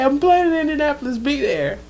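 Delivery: high, loud, quick boast; imitated excited announcement. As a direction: play an excited son telling the whole city. Voices son boasting on a newscast.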